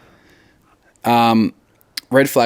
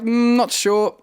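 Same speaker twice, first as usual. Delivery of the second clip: much higher, playful voice; mock unsure; 14 semitones higher, slower than usual. Host plays a lenient judge, high mock voice. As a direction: squeak it out doubtfully. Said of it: "I'd be like".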